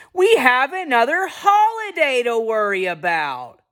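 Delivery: high, anguished cartoon whine; exaggerated wail sliding down at the end; Charlie Brown. Charlie Brown frets about the holidays, mock-anguished high whine. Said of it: "And Charlie Brown says".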